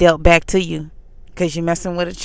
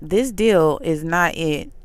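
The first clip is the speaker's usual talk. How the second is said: slowed down; 4 dB quieter, much slower than usual. Retold or imitated. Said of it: "I try to slow my voice down".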